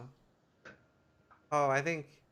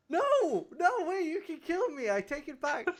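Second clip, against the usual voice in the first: high pleading voice; mock protest; 12 semitones higher than usual. Streamer pretending to beg, high and laughing. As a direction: beg in pretend panic, higher.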